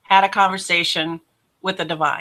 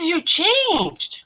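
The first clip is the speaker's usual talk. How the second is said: very high, astonished exclamation; retold amazement; 11 semitones higher than usual. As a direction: imitate a friend's disbelief, voice way up. Friend amazed at the change.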